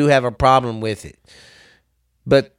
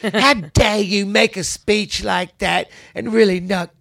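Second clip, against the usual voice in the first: mock scolding girl voice, higher and slow; mocking Greta; 7 semitones higher, slower than usual. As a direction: throw her own outrage back at her, pitched up. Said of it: "I'm gonna look at her face and go".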